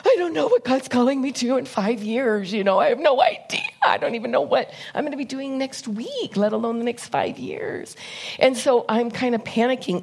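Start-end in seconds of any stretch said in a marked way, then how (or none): 0.0-8.0 higher-pitched, mildly panicky retelling; mock-anxious, voice pitched up. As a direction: act out rising inner panic. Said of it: "starting to panic a little bit".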